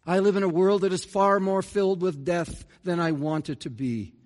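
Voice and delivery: usual speaking voice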